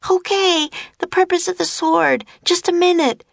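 put-on high, hurried child's shout. A narrator's reading. Annie buying time from the serpent, shouting. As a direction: call out high, stalling for time. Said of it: "shouted Annie".

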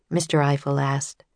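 ordinary delivery, nothing marked

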